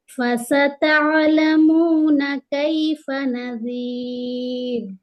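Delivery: slow melodic tajweed chant; Quran recitation. Acted. Teacher reciting the verse for students, melodic chant. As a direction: recite slowly, holding every madd.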